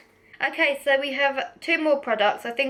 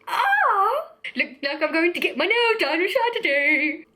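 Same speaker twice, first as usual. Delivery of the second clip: higher, playful excited exclamation; delighted; 6 semitones higher than usual. Real, not acted. Vlogger reacting to friend's nail treat text, delighted. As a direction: react with a high, gleeful "oh".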